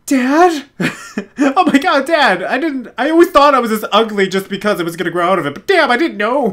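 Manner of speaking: put-on high, fast, shocked young son; gasping son. Son hearing his dad's farewell, high shocked voice. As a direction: gasp then gush at dad. Voicing son answering dad.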